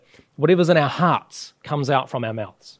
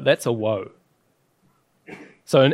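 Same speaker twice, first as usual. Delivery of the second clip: ordinary talk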